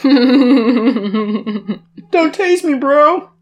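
very high, loud laughing plea; high panicked plea. Retold or imitated. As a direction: beg not to be tased. Quoting the famous yell.

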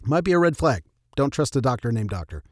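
ordinary talk

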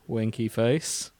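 ordinary talk

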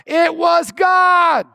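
slow, high, shouted declaration; emphatic shout. Acted. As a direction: drag it out and shout it to the back row. Pastor rebuts doubting congregation.

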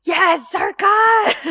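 high, loud, slow cheer of delight. Real, not acted. Viewer cheering on the hero, high and loud. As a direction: shout your support like a fan. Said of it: "I was like".

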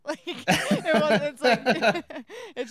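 speaking through a loud laugh. Real, not acted. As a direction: blurt it out while cracking up. Laughing at own joke.

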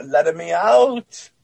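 drawn-out Mario impression in Italian English; Mario-style Italian accent. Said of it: "It talked like Mario".

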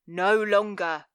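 cold, commanding guild master, drawn out; stern villain laying down the law; Master Velvet. A narrator's reading. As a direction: pronounce it as a menacing master, slow and deliberate.

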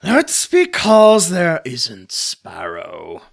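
loud, slow, pompous reply; portly fellow. Acted. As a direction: correct him with pompous authority. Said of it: "answers a portly fellow".

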